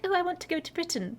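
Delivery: a high, hammed-up British accent; bad British accent. Canadian at home dreaming of Britain, in a badly faked British accent. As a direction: put on a terrible posh British accent as a joke. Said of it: "I know that was terrible accent".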